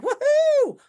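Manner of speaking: a loud, very high cheer of joy; gleeful whoop. Retold or imitated. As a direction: whoop it out like you just got sprung. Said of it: "I was just like".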